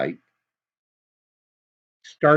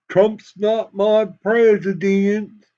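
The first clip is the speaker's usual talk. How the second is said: mock Southern drawl; 7 semitones higher, 6 dB louder, slower than usual. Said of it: "Probably shouldn't use a Southern tone".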